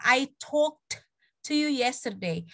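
"talked" with a released final t, then a gap; slow demo, stop on the t of "talked"; sounded past ending. Shown as a demonstration. Teacher testing students' ears, "talked" ending in a separate t. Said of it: "Ada yang beda nggak?".

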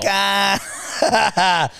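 laughing mock shout. Laughing imitation.

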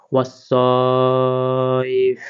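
ওয়াসসাইফ with a doubled স and a long drawn vowel; slow drawn recitation. Shown as a demonstration. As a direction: chant ওয়াসসাইফ slowly, holding the স. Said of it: "স জবর মিলবে ইয়ার সাথে".

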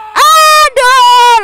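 loud high drawn-out groan; dismayed cry. Real, not acted. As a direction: groan at a sudden fall. Falls through wrong glass.

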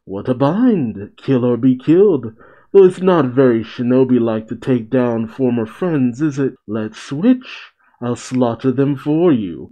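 ordinary talk, nothing marked

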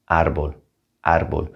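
"árbol" as AR-bol, first syllable stressed; "árbol" with the weight on ár. Shown as a demonstration. Teacher models "árbol" stressing the first A. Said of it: "put the emphasis there".

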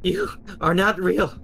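high, loud denial; yelling at the horrors; desperate yell. Acted. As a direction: shout the visions away. Narrator yelling at visions.

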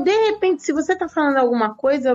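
ordinary talk

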